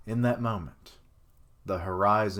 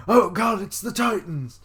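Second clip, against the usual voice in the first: much higher, loud cry of alarm; mock panicked shout; 10 semitones higher, 8 dB louder than usual. Reader joking that the rumbling is the Titans, hammed-up panicked shout. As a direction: yell it like the Titans are coming.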